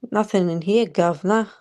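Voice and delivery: mock Cockney "governor" accent; put-on accent. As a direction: say it in a hammed-up London accent. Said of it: "See, my accent changed".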